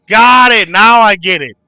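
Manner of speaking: loud, high shout of understanding; excited realization. Real, not acted. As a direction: exclaim it as the penny drops. The joke finally clicks.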